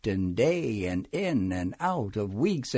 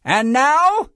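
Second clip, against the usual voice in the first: high, loud proclamation; crying out; 11 semitones higher, 7 dB louder than usual. A narrator's reading. New king addressing wild things, high loud cry. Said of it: "cried Max".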